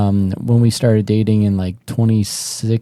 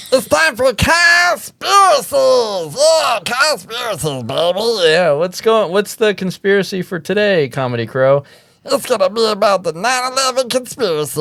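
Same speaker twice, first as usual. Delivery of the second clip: segment host; 9 semitones higher than usual. Acted. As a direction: hype up the conspiracy segment. Hosts segment in announcer voice.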